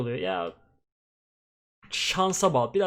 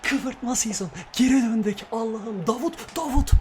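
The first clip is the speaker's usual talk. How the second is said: higher, buzzing post-goal joy; higher, giddy celebration; elated; 8 semitones higher, slower than usual. Real, not acted. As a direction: ride the high of the win. Elated after the win.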